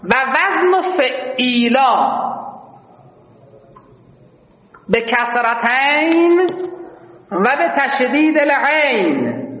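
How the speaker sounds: very slow, drawn-out reading of و وزن فعیلا; slow recitation. Shown as a demonstration. Teacher reads the grammar rule slowly to the class. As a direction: intone the rule word by word. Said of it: "reads verse text".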